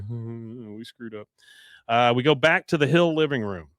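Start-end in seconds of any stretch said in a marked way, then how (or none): 0.1-1.3 soft, slow, hangdog mumble; sheepish mumble. Retold or imitated. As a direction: mumble it like scolded boys. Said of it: "They're just like".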